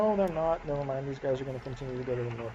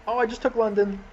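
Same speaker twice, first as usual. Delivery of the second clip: higher, louder burst of surprise; pleasantly surprised; 8 semitones higher, 6 dB louder than usual. Real, not acted. Gamer suddenly sees London has fallen, surprised. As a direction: blurt it out in sudden excitement.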